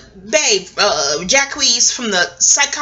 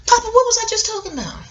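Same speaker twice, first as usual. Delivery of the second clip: high, fast call to someone off-mic; 13 semitones higher, much faster than usual. Real, not acted. Speaker calls to Papa off-camera, much higher and fast.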